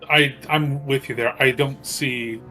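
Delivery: ordinary talk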